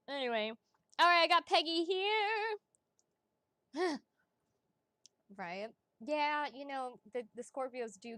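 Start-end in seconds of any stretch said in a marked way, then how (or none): 1.0-2.6 high, loud sing-out; raised, projecting voice; loud announcement. Real, not acted. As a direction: call out the next name brightly. Announcing the next reading.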